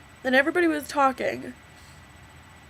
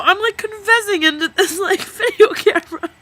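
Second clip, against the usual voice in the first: teary laugh, voice high; laughing through tears; 9 semitones higher, 8 dB louder than usual. Real, not acted. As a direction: laugh while confessing.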